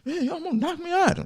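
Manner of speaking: high, squeaky mock-offended protest. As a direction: squeak it like a wounded kid. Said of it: "I'm like".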